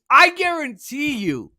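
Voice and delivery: emphatic vow. Acted. Pledging strike would force concessions.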